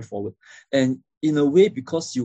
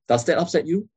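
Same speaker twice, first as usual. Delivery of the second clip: higher voice, lifting on "upset you"; question intonation; 5 semitones higher than usual. Shown as a demonstration. Trainer demonstrating a question to learners, rising tune. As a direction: ask it, ending up on "you". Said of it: "The earlier two are questions".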